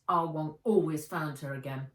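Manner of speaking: ordinary delivery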